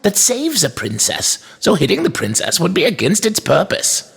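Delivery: put-on high-pitched, precise old shopkeeper; firm toy maker. A narrator's reading. Toy maker argues with inspector, firm and polite. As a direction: reason with him politely in a high voice.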